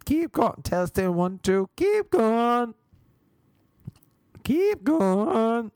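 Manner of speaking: high, loud braying "keep going" into a headset; donkey testing a microphone. Retold or imitated. Yoga teacher on her headset to the class, donkey-like high bray. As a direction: bray it like a donkey checking a mic. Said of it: "Like a donkey testing a microphone".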